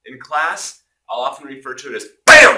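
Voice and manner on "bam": loud BAM. Shown as a demonstration. Teacher shouts BAM to wake up the class, loud. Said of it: "I hope I woke you up".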